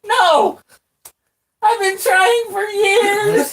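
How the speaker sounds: high squeaky wail, slow and loud. Acted. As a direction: wail it out, half laughing. Daryl wails about his curse.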